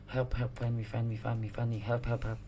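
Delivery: soft, quiet murmured repeating; ghostly plea. Acted. Ghost girl calling for help, soft. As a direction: chant it softly like a ghost. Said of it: "Dia lagi nge-rap, boy".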